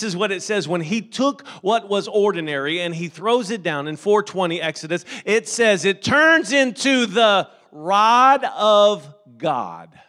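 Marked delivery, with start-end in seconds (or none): 5.2-10.0 slow, loud shouted build to the rod of God; climactic preaching. Acted. Preacher reaching the climax, slow and shouting. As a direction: stretch each word as the crowd rises.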